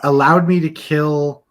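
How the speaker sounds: stunned, just caught a betrayal; shocked disbelief. Real, not acted. Learns friend could have let him kill.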